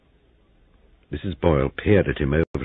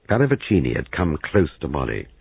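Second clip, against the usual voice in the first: plain reading voice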